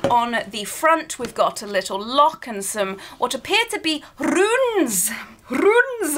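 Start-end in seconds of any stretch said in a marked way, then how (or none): 5.5-6.2 Scottish accent. Shown as a demonstration. To viewers, spotting the runes, mock Scottish. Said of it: "I don't know why I tried to go Scottish".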